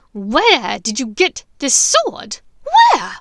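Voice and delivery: slow, loud shout rising to a shriek; furious shout. Acted. Bellatrix screaming at Hermione for the sword. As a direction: scream the question at her.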